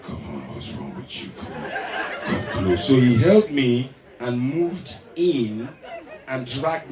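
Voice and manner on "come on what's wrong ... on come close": much lower, soft, coaxing man's voice; thick low voice. Retold or imitated. Matthew coaxing the comedian closer, low and thick. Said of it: "their voice gets thicker".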